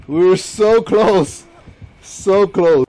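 slow, loud, high so close; drawn-out frustrated cry. Real, not acted. Gamer lamenting a near win, loud and stretched. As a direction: groan it out over the near miss.

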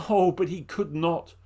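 ordinary delivery, nothing marked

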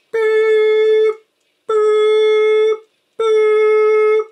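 high, loud, long held boops; high beep tones. Acted. Maker imitating the beep on the recorded message. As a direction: hold each boop like a machine tone.

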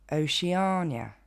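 Oceania à l'anglaise, lentement, « o-chi-A-nia »; prononciation anglaise. Shown as a demonstration. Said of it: "va se dire". (Oceania en anglais, articulé sans hâte)

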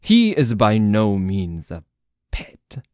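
loud, forceful objection; indignant protest. Acted. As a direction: burst out loudly in someone's defence. Indignant defence in acted quarrel.

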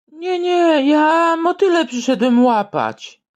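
high panicky squeak; scared hunter. Acted.

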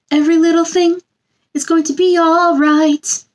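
gentle sing-song picture book reading; storybook read-aloud. A narrator's reading. Reading a picture book to children, sing-song storybook voice. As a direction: read it like bedtime story time for little ones.

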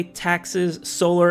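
usual speaking voice

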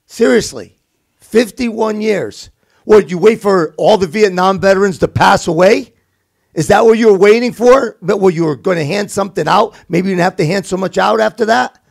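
exasperated. Real, not acted. Host venting at the fifty-one year delay, exasperated.

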